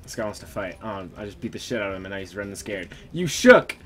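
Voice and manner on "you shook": taunting shout after a fleeing enemy; loud taunt. Real, not acted. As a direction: call out loudly to mock the runner.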